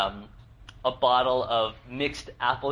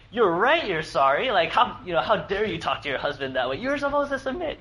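higher and louder, hammed-up self-righteous scolding; mock smug husband; 8 semitones higher, 5 dB louder than usual. Pastor mocking the smug reply to his wife, hammed-up. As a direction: play the gloating husband for a laugh. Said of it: "take advantage of that vulnerability and say".

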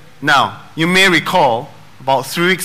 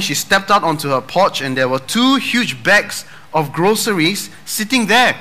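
usual speaking voice

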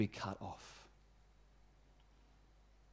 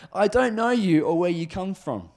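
raised, higher voice turning them away; firm rebuff; 6 semitones higher, 6 dB louder than usual. Acted. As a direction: call out a forceful refusal. Master's rebuff through the door.

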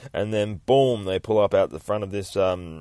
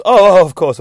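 very loud, high gush of agreement; big, loud, high of-course; exaggerated agreement; 10 semitones higher, 15 dB louder than usual. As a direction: burst in agreeing over-eagerly.

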